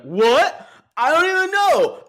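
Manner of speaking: loud, very high, over-the-top mock outburst; Gary Busey. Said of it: "in a Gary Busey voice".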